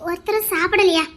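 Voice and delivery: ordinary talk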